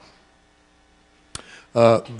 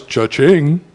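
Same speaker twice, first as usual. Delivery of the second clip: singsong cha-ching like a ringing till; cash register cha-ching. Acted. Kids voicing a banker, a singsong till sound. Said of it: "The banker goes".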